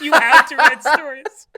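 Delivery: loud, laughing disbelief; laughing loudly. Real, not acted. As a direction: read it while cracking up.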